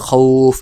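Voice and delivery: খাউফ with a slow, stretched "au"; elongated waqf ending. Shown as a demonstration. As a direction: hold the glide in খাউফ and end on a bare f. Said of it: "ওয়াকফ করলে".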